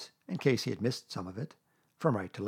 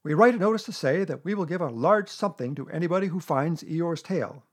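loud, raised-pitch Owl; loud proclamation; 5 semitones higher, 6 dB louder than usual. A narrator's reading. Owl explaining the notice to Pooh, loud. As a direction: announce the plan forcefully.

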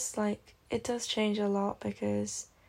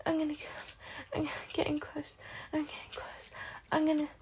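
higher, urgent begging between moans; breathless pleading; 6 semitones higher than usual. Acted. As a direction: plead breathlessly, voice rising and falling away. Intimate performance, pleading with a partner.

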